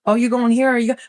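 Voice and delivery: high, sassy teasing; teasing mock voice. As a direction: needle a client playfully. Teasing a client.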